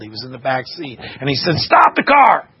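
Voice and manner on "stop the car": high, loud urgent shout; retold yell. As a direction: yell out from the back seat to halt the driver. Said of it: "and he yelled".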